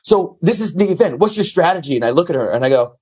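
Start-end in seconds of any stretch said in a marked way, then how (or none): 0.0-1.9 high, bright-toned mock host interview; retold host quizzing him before an event; Layla Ali host voice. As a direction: pitch it up like an upbeat TV host. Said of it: "she's like".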